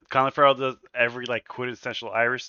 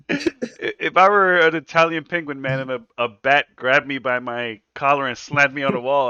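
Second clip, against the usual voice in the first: ordinary talk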